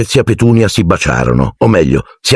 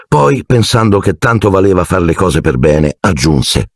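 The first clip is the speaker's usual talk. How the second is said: ordinary talk, nothing marked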